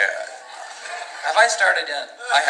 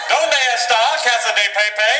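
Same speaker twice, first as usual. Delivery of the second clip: sounded-out Spanish; 10 semitones higher, 4 dB louder than usual. Acted.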